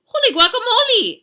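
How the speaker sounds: very high, loud cry of astonishment; shrieked comic surprise; amazed exclamation. A narrator's reading. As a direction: burst out as a startled cartoon kitten. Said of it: "exclaimed Skippy John Jones".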